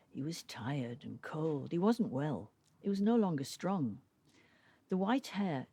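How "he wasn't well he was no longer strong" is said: usual speaking voice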